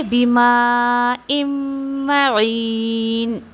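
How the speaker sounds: slow melodic tajweed recitation; chanted Quranic verse. Shown as a demonstration. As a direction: recite slowly, drawing out each sound.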